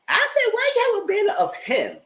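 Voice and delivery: high, squeaky disbelief; mock shock at the banner photo; incredulous question.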